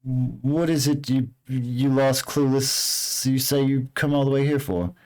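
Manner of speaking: sly stranger sizing up lost travelers; lightly voiced shady guide.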